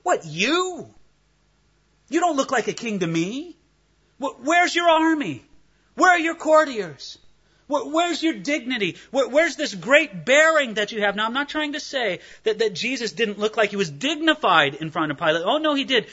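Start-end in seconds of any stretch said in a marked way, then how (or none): 0.0-11.2 scoffing Roman governor mocking a prisoner; mocking disbelief. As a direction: sneer at him like a doubting governor. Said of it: "Pilate asked the question this way".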